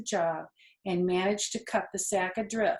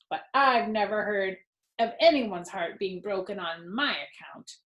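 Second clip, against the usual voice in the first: sarcastic rat; 3 semitones higher than usual. Acted. Templeton the rat sulking at Wilbur, sarcastic and self-pitying. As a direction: lay the sarcasm on thick.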